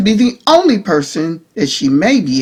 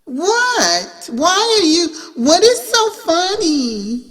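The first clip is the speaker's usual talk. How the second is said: high, slow, offended question; affronted, rising voice; offended Nicole; 9 semitones higher, 3 dB quieter, slower than usual. A narrator's reading. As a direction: demand to know what's funny, pitched up.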